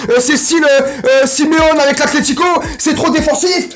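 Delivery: voix de râleur moqueuse, plus haute et plus forte; imitation d'un commentateur. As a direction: singer le fan qui critique. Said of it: "t'es en train de déjà écrire". (le youtubeur imite l'abonné qui râle, ton moqueur plus haut)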